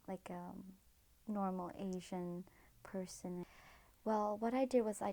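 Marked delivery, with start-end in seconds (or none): 0.1-3.4 soft, slow whispered aside. Real, not acted. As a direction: drop to a whisper, a bit embarrassed. Aside about parents' wishes.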